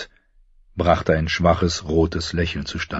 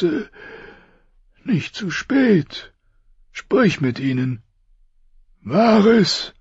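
high, strained voice of a dying king; 13 semitones higher than usual. Acted. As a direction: push each word out through pain. Robert's last requests.

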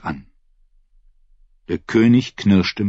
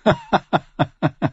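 short harsh scornful laugh; a rough, mocking chuckle; a dry, contemptuous snort of a laugh; 9 semitones higher than usual. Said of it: "lachte rau".

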